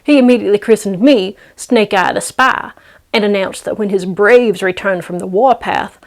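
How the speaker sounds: plain reading voice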